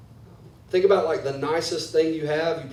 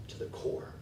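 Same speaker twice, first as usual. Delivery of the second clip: whispered ending; 15 dB quieter than usual. Acted. Prayer trailing off.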